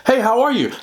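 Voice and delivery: high, bright mock doctor voice; imitating the doctor's breezy hello; doctor's greeting. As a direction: chirp it like a cheery doctor. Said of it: "and she's gonna say".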